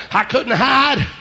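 ordinary talk

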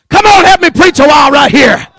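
high, loud, driving shouted cadence; fervent preacher hollering in rhythmic bursts; full-force revival preaching. Acted. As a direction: fire up the church with each punched phrase.

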